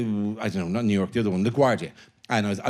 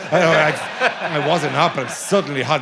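usual speaking voice

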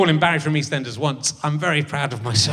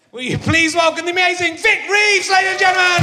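big, loud showbiz welcome, pitched way up; announcer shout; 12 semitones higher, 4 dB louder than usual. Real, not acted. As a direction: belt out the guest intro like a game show host. Host announcing the guest.

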